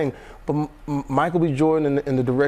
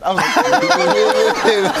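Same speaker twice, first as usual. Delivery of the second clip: laughing; 6 semitones higher, 6 dB louder, much slower than usual. Real, not acted. Laughing at daughter's boast.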